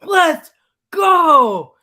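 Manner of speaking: loud, high victory yell; celebratory shout. Real, not acted. Fan hollering after Game Seven win over Boston, celebratory shout. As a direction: holler it, dragging out the 'go'.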